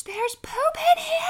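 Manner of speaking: put-on high, loud shocked toddler cry; mock child yell. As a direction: shriek it like a startled little kid. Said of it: "And he's screaming".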